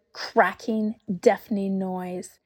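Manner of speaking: plain reading voice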